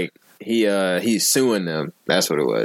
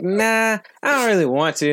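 higher, hesitant refusal; reluctant girl voice; 11 semitones higher than usual. Retold or imitated. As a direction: mimic her reluctant no. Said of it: "And she was like".

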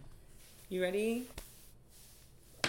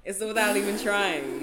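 louder, higher stab at Boston; attempted Boston accent; 4 semitones higher, 8 dB louder than usual. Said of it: "I can't do a Boston accent".

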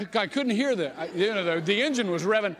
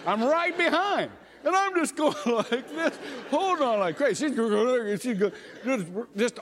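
laughing through the memory, pitched up; half laughing, higher, clinging on; 7 semitones higher than usual. Real, not acted. Preacher laughing as he retells the wave runner ride, high.

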